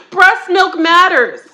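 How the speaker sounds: slow, laughing through the slogan; laughing at her own line. Real, not acted. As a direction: chuckle it out, drawn out.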